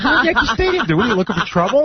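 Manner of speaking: high-pitched, incredulous mock protest; alarmed scolding. Worried fan checks his rowdy friend, retold high protest. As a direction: squeal a worried telling-off.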